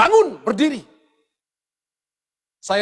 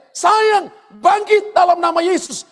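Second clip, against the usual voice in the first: high ringing shout of command; 8 semitones higher, 5 dB louder, slower than usual. Retold or imitated. Healer shouting at his dead wife's body to rise. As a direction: cry out to wake the dead. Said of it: "Dan dia teriak".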